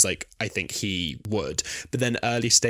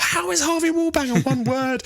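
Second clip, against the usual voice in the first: exasperated Doctor; 4 dB louder than usual. Retold or imitated. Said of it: "And he's like".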